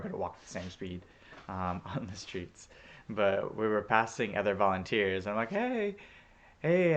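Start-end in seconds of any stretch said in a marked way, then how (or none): 5.5-7.0 high, loud re-enacted "hey"; calling out greeting. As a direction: call out to someone you're passing. Calling out to passing volunteer.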